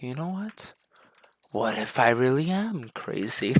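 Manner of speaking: very soft, eerie musing; newly insane inmate. Acted. Inmate deciding he is crazy, softly unhinged. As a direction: ask it quietly, as if losing your mind. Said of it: "you become insane".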